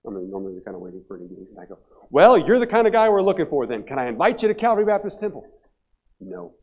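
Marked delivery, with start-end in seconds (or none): 2.1-5.5 higher-pitched, chirpy sales pitch; undeterred eagerness. Retold or imitated. Eager pastor undeterred by refusal. 6.2-6.5 low, very quiet no; curt refusal. Retold or imitated. Old man refuses again.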